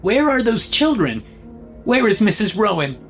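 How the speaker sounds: high-pitched teacher calling from the back; insistent high-voiced questioning. A narrator's reading. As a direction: pitch it up and call across the room. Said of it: "called from near the back".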